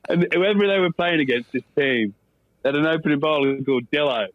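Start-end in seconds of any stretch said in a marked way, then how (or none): none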